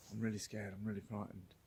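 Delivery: a guide quietly admitting fear, hammed-up; mock frightened whimper. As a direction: shrink the words into a timid murmur. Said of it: "our guide turned round and went".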